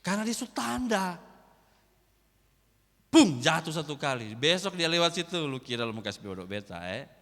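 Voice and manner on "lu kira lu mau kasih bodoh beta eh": cheeky retort. Retold or imitated. Mocking donkey in dialect.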